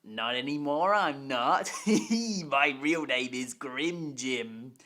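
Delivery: slow, gloating evil engine with a laugh; sinister villain voice. Acted. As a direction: let the laugh roll into the reveal, slowly. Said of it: "why does your voice sound like that".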